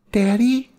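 put-on high, small frightened child; scared child voice. A narrator's reading. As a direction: call out like a scared kid. Frightened child in back seat.

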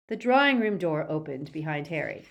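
plain reading voice

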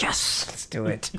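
eager whisper. Retold or imitated. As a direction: whisper it like finally. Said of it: "I was like".

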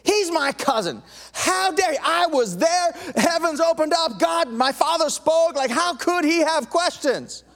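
mock indignant Jesus. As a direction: voice a huffy, wounded Jesus. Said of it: "they're expecting Jesus to say".

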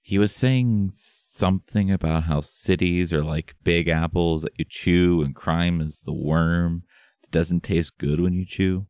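ordinary delivery

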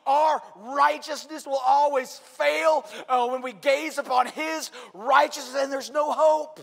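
loud, high, ringing sermon voice; full-force preaching. Acted. Pastor preaching to the congregation about God, loud and ringing. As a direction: proclaim it to the whole church.

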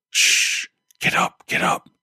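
urgent whisper; urgent resistance whisper. Acted. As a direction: hiss it out, fast and pressing. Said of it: "John whispered urgently".

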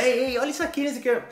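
imitating a guy calling someone over. A guy shouting to friends, mock high call. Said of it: "Aí o cara fala".

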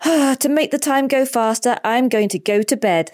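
put-on sleepy kid announcing bedtime; stifling a yawn. A narrator's reading. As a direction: sigh in, then speak as if yawning. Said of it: "stifling a yawn".

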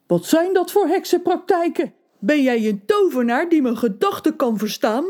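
a touch of panic; scared tailor. A narrator's reading. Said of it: "De kleermaker keek bang".

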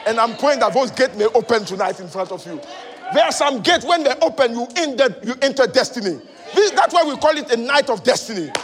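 loud, high, rousing preacher's declaration; impassioned preaching. Real, not acted. Preacher promising the congregation an open gate, fired-up preaching. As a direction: preach it out at full force.

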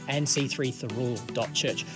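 normal talking voice

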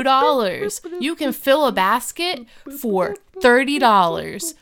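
loud, punchy mock announcer; hard-sell the bargains, landing on each price; hammed-up store commercial; parody ad read.

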